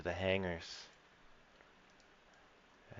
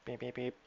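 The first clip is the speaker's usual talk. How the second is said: higher, softer electronic beeps; computer beeps; 5 semitones higher, 6 dB quieter than usual. Acted. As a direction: beep like a ship computer. GM voices ship computer.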